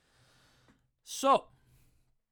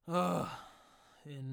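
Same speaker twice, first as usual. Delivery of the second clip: usual speaking voice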